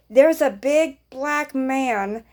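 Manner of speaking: slow, careful, every word spaced out; slow radio report; slow and deliberate. Retold or imitated. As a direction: spell it out slowly over the radio. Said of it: "and this is how I said it".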